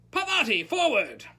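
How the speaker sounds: loud, high teacher's summons; shouted command. A narrator's reading. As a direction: shout the pupil forward. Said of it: "Professor Lupin shouted".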